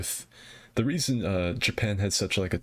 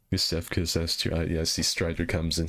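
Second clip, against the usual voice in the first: ordinary talk, nothing marked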